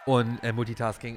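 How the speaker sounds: normal talking voice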